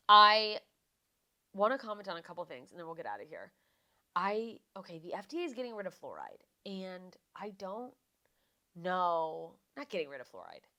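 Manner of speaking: ordinary talk, nothing marked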